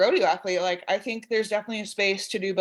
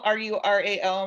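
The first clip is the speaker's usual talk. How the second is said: normal talking voice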